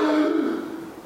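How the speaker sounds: high, drawn-out ooh; mock gasp of a watching crowd; onlookers' reaction. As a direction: imitate a crowd taken aback. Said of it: "they looked like".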